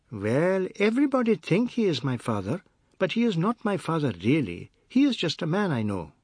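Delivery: put-on high-pitched little boy's voice; young boy explaining. Anand answers the stranger about his father, high young boy.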